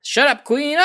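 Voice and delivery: high-pitched gleeful rebel voice; put-on, high-pitched defiance; defiant underlings. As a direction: act out henchmen talking back. Acting out Pleasures rebelling against Queen.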